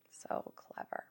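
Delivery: hushed, whispered aside. Real, not acted. Comic reacting to the lyrics, whispered aside.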